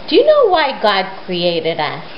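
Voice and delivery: plain reading voice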